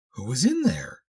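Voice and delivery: character's insistent question; demanding question. A narrator's reading. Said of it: "she demanded".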